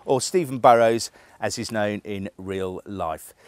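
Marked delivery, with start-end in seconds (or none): 1.4-3.3 mock solemn. Shown as a demonstration. Contrasting online name with real name.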